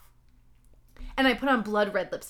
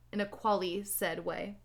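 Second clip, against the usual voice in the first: ordinary delivery, nothing marked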